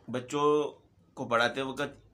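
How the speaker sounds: normal talking voice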